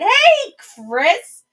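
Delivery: bright shout of welcome; spotting a friend arriving in the chat, calling out happily; excited greeting. Real, not acted. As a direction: call out high and loud to a friend. Greeting a viewer joining the stream.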